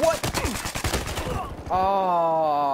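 startled. Real, not acted. Startled by enemy.

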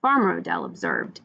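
plain reading voice

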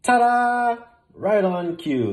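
loud, high showman's ta-da; excited. A narrator's reading. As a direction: announce it like a stage magician. Bear pops out of hat.

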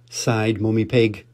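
usual speaking voice

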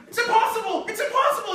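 high, loud frantic cry; panicked goblin. Acted. Goblin sees rock broken, cries out high and panicked. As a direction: shriek it in disbelief.

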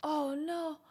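usual speaking voice

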